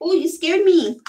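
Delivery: high, loud startled cry; startled by the cat, crying out. Real, not acted. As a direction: gasp and yelp in surprise.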